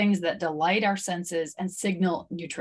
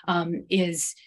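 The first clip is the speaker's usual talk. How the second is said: usual speaking voice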